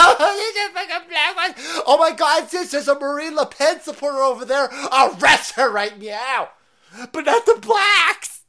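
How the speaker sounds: loud, high, hammed-up crying; shrieky fake sobbing that builds; mock hysterical begging. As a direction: wail and plead in exaggerated mockery. Mocks the woman begging and crying.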